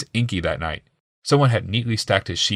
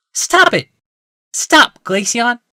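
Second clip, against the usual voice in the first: much higher and louder cry; angry plea; 6 dB louder, much slower than usual. A narrator's reading. Wife stopping her husband's accusations, sharp cry. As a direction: cut him off hard. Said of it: "Rebecca hissed".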